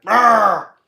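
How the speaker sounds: loud growling roar; big pirate-ish roar; monster roar. Retold or imitated. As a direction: roar like a rowdy spirit. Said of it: "have the Mosh come in and be like".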